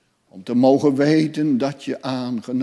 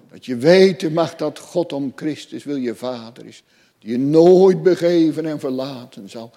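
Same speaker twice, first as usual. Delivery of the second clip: ordinary talk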